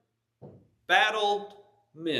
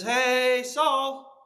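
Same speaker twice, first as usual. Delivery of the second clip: louder, higher call across a distance; David calling to Saul as he leaves; 5 semitones higher, 4 dB louder than usual. Acted. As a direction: hail a man walking away. Calling after a departing king.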